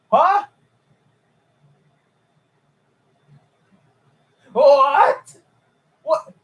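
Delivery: can't believe your eyes; disbelieving what. Real, not acted. Reacting to the track record.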